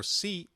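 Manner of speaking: the Latin American "si"; Latin American si sound. Shown as a demonstration. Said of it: "in Latin American Spanish".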